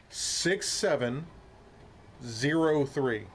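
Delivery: slow, deliberate, number by number; slow careful dictating. Real, not acted. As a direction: spell out each number slowly. Slow careful dictation after mistake.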